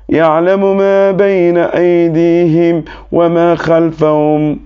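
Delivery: chanted Quranic recitation, steady and flowing. Acted. Learner giving thanks after the lesson, reciting in a chanted tajweed style. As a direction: intone the verses devoutly, in a measured chant.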